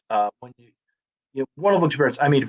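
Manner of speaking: normal talking voice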